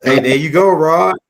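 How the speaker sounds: loud, hearty call of agreement; loud approving call. Real, not acted. Host cheering Rod's line about loving your people, loudly. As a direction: shout approval across the mic.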